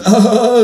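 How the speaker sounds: high, loud cry of shock; pained scream. A narrator's reading. Man blinded by a flashlight, screaming. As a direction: scream as if suddenly dazzled. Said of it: "schrei ich".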